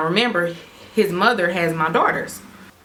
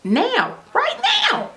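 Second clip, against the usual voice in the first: squeaky dare; slower than usual. Retold or imitated. She dares them.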